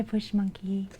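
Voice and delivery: ordinary talk, nothing marked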